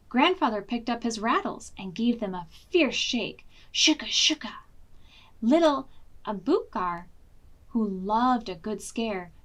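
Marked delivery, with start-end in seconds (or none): 3.7-4.5 whispered rattling syllables; rattle sound. A narrator's reading. Grandfather shakes his rattles, whispered rattle sound.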